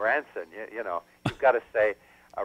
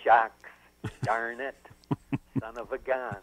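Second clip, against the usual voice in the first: Jack Nicholson; 4 dB quieter, slower than usual. Retold or imitated. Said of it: "Jack is saying".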